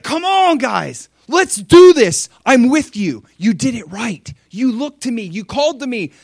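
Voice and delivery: high, loud cheer easing into eager encouragement; rally them with delighted energy; happy and excited. Acted. God cheering on his victorious people, happy and excited. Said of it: "the happy heart of God getting happy".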